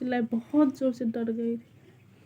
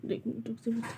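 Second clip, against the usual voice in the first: soft aside; 9 dB quieter, faster than usual. Real, not acted. Hushing her child mid-vlog.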